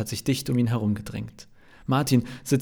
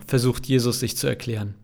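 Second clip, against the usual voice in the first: ordinary delivery, nothing marked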